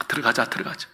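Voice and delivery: low, soft coaxing; coaxing father. Acted. Father softly coaxing his sulking son to come in. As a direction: gently urge him to come inside. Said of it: "나가서 어떻게 해요".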